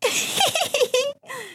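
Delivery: squealing high giggle; high piglet giggle. A narrator's reading. Piglet laughs.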